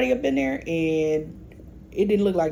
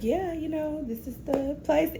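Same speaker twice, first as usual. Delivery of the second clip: lightly voiced granny; 9 semitones higher, 4 dB quieter than usual. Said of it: "she's like".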